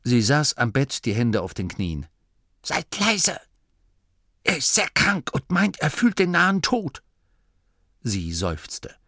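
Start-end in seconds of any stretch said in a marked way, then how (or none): 2.6-7.0 put-on bossy old Oma. A narrator's reading. Oma Morky hushing the young nome at the sickbed. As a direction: play a stern old granny hushing visitors. Old woman voiced in story.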